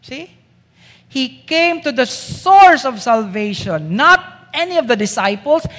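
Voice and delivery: normal talking voice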